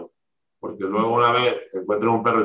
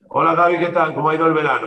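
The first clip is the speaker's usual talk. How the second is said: cheerful greeting; 7 semitones higher, 4 dB louder, faster than usual. Retold or imitated. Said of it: "ha sido".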